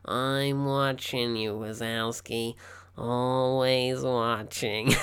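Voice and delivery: very slow, drawn out Roz voice; slow creepy Roz impression; imitating Roz. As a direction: drag each word like a watchful clerk. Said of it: "Roz as well".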